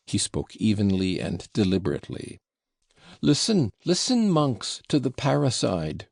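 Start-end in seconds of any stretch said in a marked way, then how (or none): 3.2-6.1 crying out. Acted. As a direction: call out for all to hear. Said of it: "cried Fyodor Pavlovitch".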